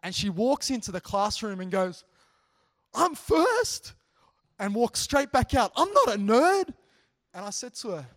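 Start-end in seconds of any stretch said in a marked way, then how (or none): 2.9-3.9 very high, loud mock exclamation; imitated dismayed outburst. Student arriving before all the other girls, loud mock dismay. 5.8-6.7 very high, loud mock squawk; imitated indignant protest. Student walking straight back out of class, loud mock indignation.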